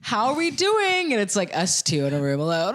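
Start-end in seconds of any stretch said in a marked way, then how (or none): 0.0-1.1 high, drawn-out hyped host greeting; comedy show host. Retold or imitated. Co-host mocking himself hyping a room of two, comedy show host voice. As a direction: work the crowd, pitched way up and slow. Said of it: "I go in my comedy show host voice".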